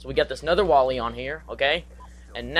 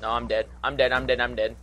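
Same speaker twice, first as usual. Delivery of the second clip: ordinary delivery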